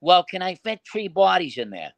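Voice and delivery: put-on innocent-sounding question in a Boston wiseguy accent. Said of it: "put that Boston accent there".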